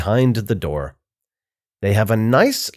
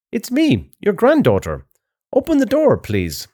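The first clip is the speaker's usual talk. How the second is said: wolf posing as the granddaughter, pitched way up; very high voice; 10 semitones higher than usual. A narrator's reading. Wolf tricking grandma at the door, in a very high voice. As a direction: put on a high little-girl voice, falling at each phrase end. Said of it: "His voice is very high now".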